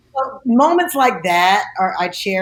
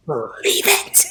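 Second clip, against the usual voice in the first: imitating Oprah; 4 semitones lower, slower than usual. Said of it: "and said".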